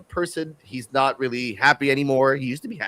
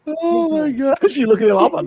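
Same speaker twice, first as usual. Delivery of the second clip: surprised; 11 semitones higher than usual. Real, not acted. Shocked exclamation.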